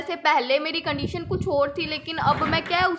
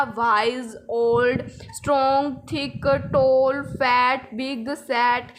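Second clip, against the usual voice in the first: slow list, each word in one lower-pitched beat; 4 semitones lower, much slower than usual. Shown as a demonstration. Teacher lists one-syllable adjectives for students, one beat each. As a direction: read each adjective as a single separate syllable, slow and low. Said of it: "एक आवाज़ में बोल जाते हैं".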